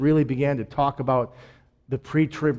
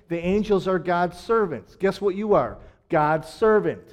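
ordinary delivery

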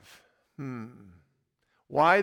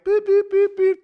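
much higher bleeping; bleep censor; 8 dB louder than usual. Retold or imitated. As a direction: bleep out the swearing. Censoring the cop's curses.

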